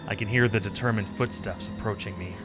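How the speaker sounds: plain reading voice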